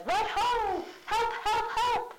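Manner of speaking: very high mock yelp for help; hidden Polonius crying help. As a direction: squeal it out from behind the curtain. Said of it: "Polonius says".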